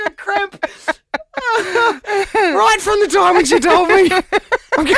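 high, breathless laughter through the words; can barely get it out for laughing; laughing helplessly. Real, not acted. Host cracking up telling his co-host the story, laughing.

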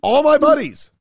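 high, loud comic climax; gleeful exclamation. Acted. Talking about loving his money, high gleeful cry. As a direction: burst out high on the last of the list. Comic roll call of banknote faces.

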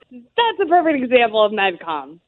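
ordinary talk

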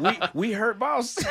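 high, loud imitation breaking into laughter; put-on pleading voice, laughing through it; mock servile slave. Host repeating his slave mentality joke, mock servile voice through laughter. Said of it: "You gotta get rid of your slave mentality".

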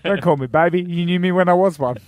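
a hint of a put-out young man; playful role voice. Voicing Cher's young partner.

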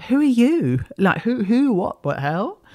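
a startled man asking an invisible voice who it is, panicked; alarmed demand. Retold or imitated. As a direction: blurt it out, rattled by a voice from nowhere. Man confronting unseen voice.

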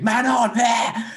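high yelled call from the stands; high mock crowd yell; crowd's scream. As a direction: shout it like a whole stadium. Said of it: "the whole crowd just screamed".

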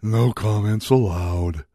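slow, low, dim-witted impression with halting pauses; Nick Coleman. Radio host mocking a newspaper columnist, slow dopey impression. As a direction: drag it out like someone with a head injury. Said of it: "That's kinda how Nick Coleman sounds".

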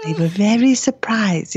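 slow, prim mock Dutch accent as the mum; light Dutch accent, mimicking a polite old lady; slight Dutch accent, deliberate and prim; imitating a Dutch accent. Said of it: "she has a little Dutch accent".

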